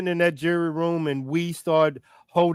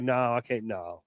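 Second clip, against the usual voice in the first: mock breaking down; 6 semitones lower, 9 dB quieter than usual. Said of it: "I'm gonna be like".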